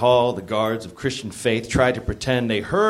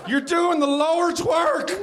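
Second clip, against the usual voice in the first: high, slow theatrical exclamation; hammed-up cry; slower than usual. A narrator's reading. Narrator plays Jim urging Sam on, hammed-up high cry. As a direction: cry out high, stretching the words. Reader voices Jim mid-scene.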